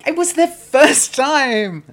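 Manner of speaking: loud, high, drawn-out protest; protesting outburst. Real, not acted. Defending themself against co-host's teasing, loud drawn-out protest. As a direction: wail it slowly in self-defence.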